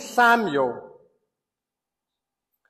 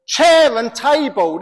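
normal talking voice

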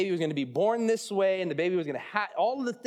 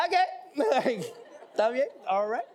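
high, laughing, sheepish agreement; sheepish backing off; 7 semitones higher than usual. Retold or imitated. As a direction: give in nervously with a pitched-up voice. Rebuffed husband giving in, laughing.

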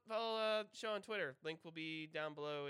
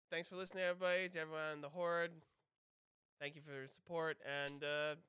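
upbeat, louder host farewell; podcast sign-off; 4 dB louder than usual. Acted. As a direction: wrap up the show cheerfully.

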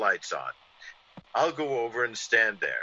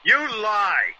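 higher, louder snapped accusation; angry shout; 9 semitones higher, 5 dB louder than usual. Acted. Kerrigan cutting off Devereaux's bluff, shouting. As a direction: cut him off hard. Villain cuts off writer.

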